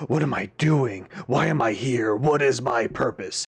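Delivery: mock angsty brooding, a hint of drama. Amnesiac hero questioning his purpose, mock angsty. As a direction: ham up the lost hero's despair. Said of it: "in an angsty way like I made it sound".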